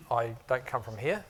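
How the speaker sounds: usual speaking voice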